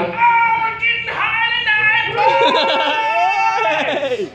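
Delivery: very high, drawn-out singsong whoop; rowdy tweaker. Retold or imitated. Toothless guy cheering over the stolen cash, high singsong whoop. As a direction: howl it like a toothless partier celebrating. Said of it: "some guy's back there with four teeth going".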